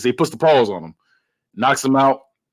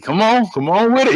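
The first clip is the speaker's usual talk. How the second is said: higher-pitched mock challenge; retold goading; 8 semitones higher than usual. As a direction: goad him on, acting out the movie villain. Retold villain goading his opponent.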